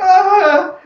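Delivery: sharp high yelp of pain; pained cry. Acted. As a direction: cry out as the snake bites. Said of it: "He's like".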